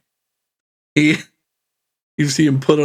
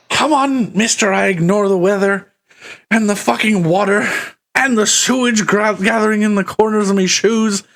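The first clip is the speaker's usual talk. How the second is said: much higher, quickening complaint; exasperated npc; 6 semitones higher than usual. Acted. GM voicing the npc, griping about the sewage, much higher. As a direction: grumble on the way through the sewage.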